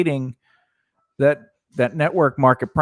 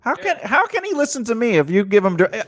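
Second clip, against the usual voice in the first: ordinary talk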